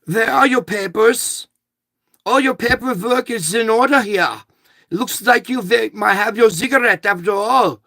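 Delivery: high, loud-ish German clerk impression; German accent. Clerk approving a customer's paperwork, hammed-up imitated German accent. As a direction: play up her German accent, pitched well up. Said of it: "with a German accent".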